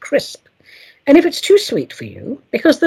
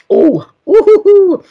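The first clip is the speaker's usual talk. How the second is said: boozy hoots; 10 semitones higher, 7 dB louder than usual. Real, not acted. Reacts to strong cocktail sip.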